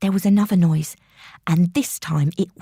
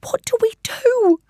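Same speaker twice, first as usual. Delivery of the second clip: high, breathless frightened whisper; a scared girl mouthing the question; mouthed whisper. A narrator's reading. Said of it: "I mouthed at her".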